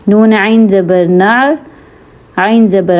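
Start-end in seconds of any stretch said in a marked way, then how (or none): none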